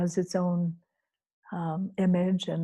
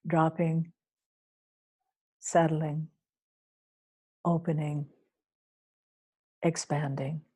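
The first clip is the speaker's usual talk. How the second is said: lower, single words with long pauses; calm, hushed guidance; 3 semitones lower, 4 dB quieter than usual. Real, not acted. Guide easing listeners into settling and opening, hushed calm. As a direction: let each word sink and fade into silence.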